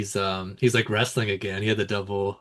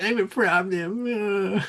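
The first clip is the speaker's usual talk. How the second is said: higher, very slow smug boast in a put-on voice; mocking boast, drawn out; 7 semitones higher, much slower than usual. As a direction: stretch out the brag like a caricature.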